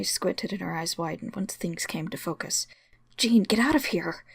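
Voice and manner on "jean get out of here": urgent shout. Acted. Urgent warning to friend.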